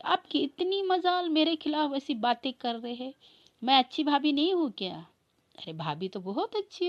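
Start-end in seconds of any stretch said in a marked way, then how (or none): 0.1-5.1 pitched-up, louder indignant scold. Acted. Bhabhi scolding devar for badmouthing her, high indignant scold. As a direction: scold him, voice climbing high.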